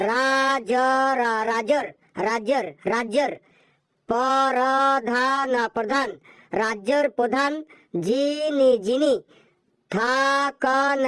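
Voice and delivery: slow, stumbling syllable by syllable reading; halting reading. Acted. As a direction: spell it out haltingly like a struggling pupil. Halting child reading aloud. (থেমে থেমে হোঁচট খেয়ে পড়া)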